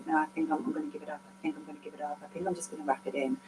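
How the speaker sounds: barely audible, drained giving-up murmur; defeated murmur. Retold or imitated. Telling viewers how she nearly quit, hushed and defeated. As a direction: sink into a soft, fast mutter of surrender. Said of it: "on the day that I was like".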